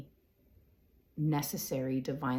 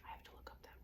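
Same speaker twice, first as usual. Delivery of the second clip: real whispered aside, much softer; 22 dB quieter, much faster than usual. Real, not acted. Noting to herself she must look up a word, whispered. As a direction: mutter it under your breath.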